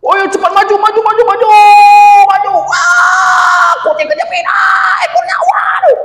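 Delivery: squealing gamer. Acted. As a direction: shriek at the game as it goes wild. Bobon yelling at the game.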